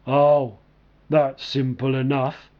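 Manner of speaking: low, unhurried big man voice; slow and low, a lightly voiced gentle giant; deep slow voice. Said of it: "he began in a deep, slow voice".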